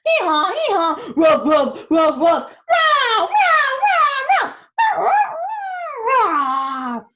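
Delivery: very loud, high braying, barking, crowing; animal racket. Acted. Four animals make as much noise as possible to frighten robbers, very loud. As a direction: bellow every animal noise at once.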